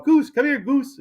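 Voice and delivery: high excited call to a dog. Retold or imitated. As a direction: sing out his name like calling a happy pup. Calling the dog happily.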